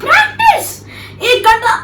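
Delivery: high, laughing disbelief at the idea; mock outrage with a laugh; incredulous laughing shout. Real, not acted. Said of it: "ये practice करने में एक घंटा लगाती है".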